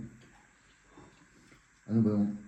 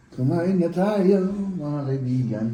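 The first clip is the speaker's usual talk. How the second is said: loud, high, drawn-out call to friends; slow, raised-voice mealtime announcement; calling out to eat; 9 semitones higher, 7 dB louder, slower than usual. Real, not acted. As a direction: sing out the invitation, long and loud. Calls viewers to come and eat.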